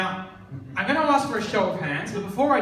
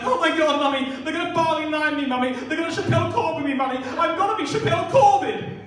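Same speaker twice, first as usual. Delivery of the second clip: put-on higher whiny mummy's-boy voice; panicky rich kid; 6 semitones higher, 3 dB louder than usual. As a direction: beg mummy like a spoiled schoolboy. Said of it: "and then he was like".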